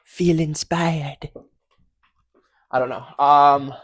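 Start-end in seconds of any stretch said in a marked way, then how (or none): 0.0-1.3 loud, slow stage whisper; inspirational intro. Pretend. Host auditioning openings, mock-inspirational in a forceful whisper. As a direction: draw it out forcefully as a mock command. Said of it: "which one of those you think was the most inspirational".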